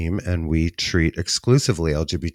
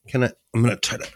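cramped, wriggling while talking; squeezing in tight space; 5 semitones higher, faster than usual. Real, not acted. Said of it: "we're so tight in here".

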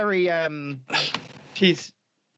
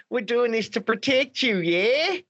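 higher, big-smile Antipodean voice; Jacinda Ardern; 5 semitones higher than usual. Acted. Prime minister reassuring the public, toothy smiling impression. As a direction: grin through it, lifting on the "yeah?". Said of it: "big smile on her face".

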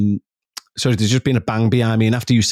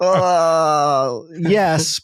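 hesitant reply; 4 semitones higher than usual. Retold or imitated. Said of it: "And I'd be like".

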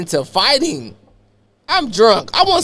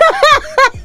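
shrill mock cackle; 3 dB louder than usual. Cousin's laugh imitated, very high.

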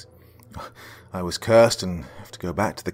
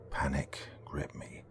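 slow panicked whisper; 3 semitones lower, 13 dB quieter, slower than usual. A narrator's reading. Narrating panic.

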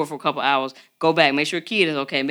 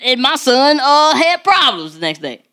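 louder, higher, lightly voiced anxious parent; a panicked caller reporting trouble; worried parent; 5 semitones higher, 4 dB louder than usual. Listener's parent calling in panic, louder and higher. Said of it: "they gonna call me like".

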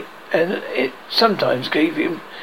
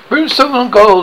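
high, loud pirate oath; mimicked captain's outburst; retorting; 8 semitones higher, 7 dB louder than usual. A narrator's reading. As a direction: fire back a theatrical curse. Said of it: "the poor voice retorted".

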